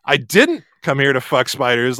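"didn't" pressed with resentment; stress on didn't. Shown as a demonstration. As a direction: deny it by leaning on "didn't". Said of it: "And then you could say".

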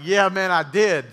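mimicking a friend cracking up as he admits it; laughing. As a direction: laugh it out, no shame. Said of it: "He just starts laughing".

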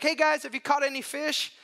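a friendly call from the beach. Retold or imitated. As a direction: shout it out to the boat from shore. Jesus calling out from shore.

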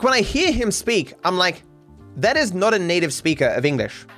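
normal talking voice

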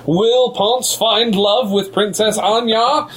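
slow dramatic teaser question; cliffhanger hushed stop. Pretend. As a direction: draw out the suspense.

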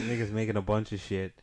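ordinary talk

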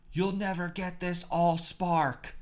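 high, slow, overdramatic action-hero line; Shia LaBeouf line reading. Retold or imitated. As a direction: ham it up like a blockbuster hero shouting his big line. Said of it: "And the line was".

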